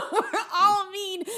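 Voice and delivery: slow, high laughing repeat. Real, not acted. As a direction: drag it out through laughter.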